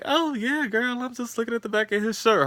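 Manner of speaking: very high, sing-song fake-innocent cover; high, breezy put-on voice covering a lie; fake innocent Issa. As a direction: squeak out an obvious lie, all innocence. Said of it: "And Issa's like".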